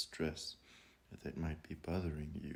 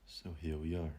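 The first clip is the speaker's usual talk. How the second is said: guided meditation; 8 dB quieter than usual. Acted. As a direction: ease them gently into calm, drawing the words out. Leads a breathing meditation.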